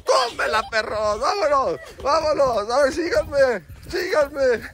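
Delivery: high-pitched gleeful crowing; playful celebration. Real, not acted. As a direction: crow over the goal in a high voice. Festeja el gol con el niño. (festejo juguetón y agudo)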